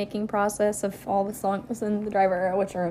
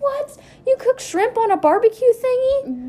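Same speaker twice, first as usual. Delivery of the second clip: much higher, laughing disbelief; shocked disbelief; 13 semitones higher, 4 dB louder than usual. Retold or imitated. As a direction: squeal it out in amazed confusion. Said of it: "And I was like".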